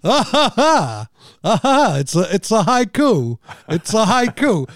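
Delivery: loud, hammed-up fake laughing delivery; mock boastful guffaw at own joke; Christopher Walken. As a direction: exclaim it loudly through put-on laughs. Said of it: "Christopher Walken saying".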